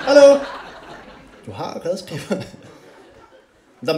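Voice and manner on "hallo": mock call. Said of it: "mock driver".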